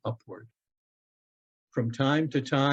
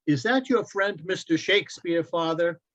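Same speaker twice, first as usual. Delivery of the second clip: put-on higher, eager boy's voice; young boy Loren; 5 semitones higher than usual. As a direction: play the son, bright and eager. Boy asks father.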